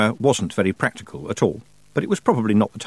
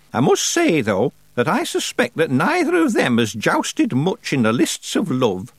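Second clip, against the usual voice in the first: put-on raised, deliberate voice of an imperious old witch; old witch Miss Treason; 5 semitones higher than usual. Old witch advising young Tiffany, imperious and slow. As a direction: play the grand old witch holding court.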